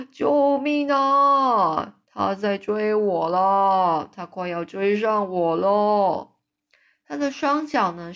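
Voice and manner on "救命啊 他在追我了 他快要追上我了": screaming for help. A narrator's reading. Giant in a nightmare screams that Jack chases him. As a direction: cry out as if being chased. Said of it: "一边尖叫说".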